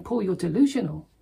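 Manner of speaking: a Roman governor ridiculing a prisoner; Festus scoffing at Paul. Acted. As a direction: scornfully tell Paul he's lost his mind. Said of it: "He's basically saying".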